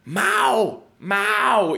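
high, loud call of a name; mock calling-out yell; imitated group shouting. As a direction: shout like girls calling across a party. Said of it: "me empezaron a gritar".

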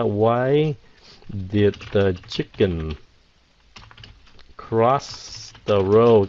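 very slow, drawn-out riddle; slow quoted riddle. Shown as a demonstration. Teacher quoting the original riddle slowly. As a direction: spell out the old riddle slowly. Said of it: "cái câu cũ nó rằng là".

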